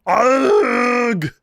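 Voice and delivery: loud, high, strained Austrian grunt; a quick action-hero yell; Arnold impression. Shown as a demonstration. Host gives chat one Arnold impression, a loud grunt. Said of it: "Arnold impression".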